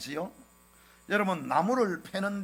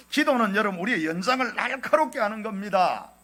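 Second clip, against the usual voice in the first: louder, higher impassioned sermon climax; preaching at full force; 4 semitones higher, 6 dB louder than usual. Acted. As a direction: build to a fervent pulpit cry.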